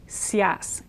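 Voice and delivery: Sias as one syllable, I gliding to a Y; blended sias. Shown as a demonstration. Teacher isolating the sias ending. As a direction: sias whispered, one beat. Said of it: "blends so it becomes sort of like a Y".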